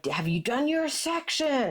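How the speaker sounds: playful high-pitched nagging question; mock nagging. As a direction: imitate yourself checking up on him, pitched high. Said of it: "I've been nagging him".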